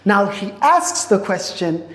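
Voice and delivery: normal talking voice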